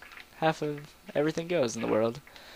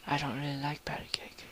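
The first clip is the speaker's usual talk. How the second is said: whisper; 2 semitones higher, 7 dB quieter than usual. Acted. Whispered inner thought in story.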